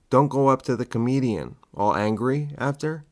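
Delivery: normal talking voice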